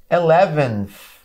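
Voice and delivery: "Eleventh." slow and loud, ending in an f sound. Shown as a demonstration. Teacher shows students the easier f ending, slow and loud. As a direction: stretch "Eleventh." and finish it with an f. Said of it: "Olha o sonzinho do F".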